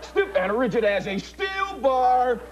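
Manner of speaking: slow, drawn-out stiff and rigid; hypnotic command. Acted. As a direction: stretch out each command. Repeated rigidity suggestion.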